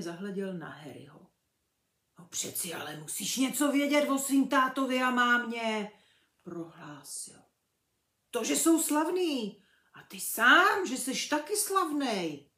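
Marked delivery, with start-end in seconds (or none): 2.2-5.9 big booming giant, loud and rough-hearted; hearty half-giant, loud and emphatic; Hagrid's voice. A narrator's reading. Hagrid insists to Harry. 8.3-12.5 big booming giant, loud and rough-hearted; hearty half-giant, loud and emphatic; Hagrid's voice. A narrator's reading. Hagrid tells Harry he's famous.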